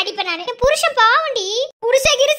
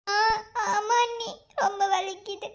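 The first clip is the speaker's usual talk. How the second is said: softer, tearful whimper; crying in pain; 2 semitones lower, 6 dB quieter than usual. Acted. Girl cries to sister-in-law that it hurts. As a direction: whine through tears.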